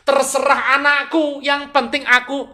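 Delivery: high, loud, self-centred declaration; acted selfish indifference. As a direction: mimic someone who cares only for themselves. Mimics a selfish parent. (lantang, egois dan masa bodoh)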